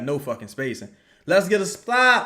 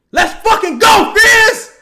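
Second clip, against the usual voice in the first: full-throated high cheer, much louder; ecstatic shout; 14 dB louder than usual. Real, not acted. Fan watching the game, screaming with joy at a great play. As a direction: roar it out for Fizz's shot.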